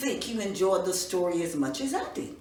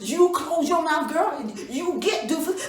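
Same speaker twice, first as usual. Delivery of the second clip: angry father; 5 semitones higher, 6 dB louder than usual. Acted. Father shouts at daughter.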